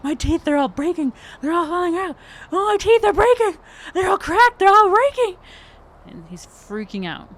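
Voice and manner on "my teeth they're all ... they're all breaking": high, loud frantic wail about his teeth; panicked wail. Acted. Game master voicing a doctor panicking over his teeth, high frantic wail. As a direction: shriek it in total panic.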